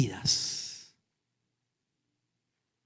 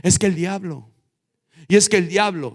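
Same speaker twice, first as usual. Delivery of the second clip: blaming excuse; 6 semitones higher, 3 dB louder than usual. Acted. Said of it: "le echamos la culpa al diablo".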